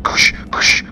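unvoiced imitated stomping noise; whispered kush sound. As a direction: hiss out the step sounds. Imitating loud footsteps noise.

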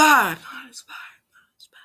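fading, hushed repeats; hushed copy trailing away to almost nothing; whispered echo. Acted. Same line repeated as fading echo.

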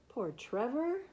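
ordinary delivery, nothing marked

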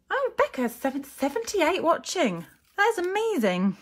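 high, loud delighted hello to a viewer; light up at seeing a friend's name; real joy at the viewer count; excited greeting. Real, not acted.

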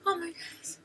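breathless whispered gasp of wonder; real hushed awe; awed whisper. Real, not acted. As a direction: whisper it, too stunned to speak. Stunned fan reacting quietly.

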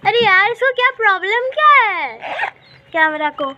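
fed up with the camera; frustrated. Real, not acted. Vlogger annoyed at her falling camera, exasperated.

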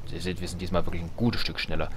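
ordinary delivery

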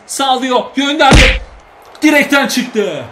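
high, loud, slow cry as the shot flies; excited shout. Real, not acted. Gamer crying out as Salvio's shot hits the post. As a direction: call the shot in alarm.

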